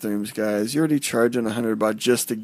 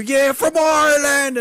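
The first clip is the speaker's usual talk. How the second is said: high, loud cheering shout; loud, high party shout of being Irish; shouted claim; 13 semitones higher, 4 dB louder than usual. Retold or imitated. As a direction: yell it out to a cheering crowd. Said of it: "and scream".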